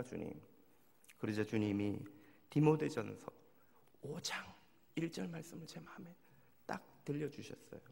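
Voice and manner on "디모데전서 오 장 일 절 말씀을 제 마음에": normal talking voice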